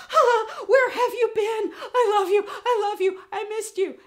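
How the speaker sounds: high, loud, overexcited dog voice; codependent dog. Acted. Dog greeting owner back from the mail, frantic and gushing. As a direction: gush like a needy dog. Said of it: "One is totally codependent".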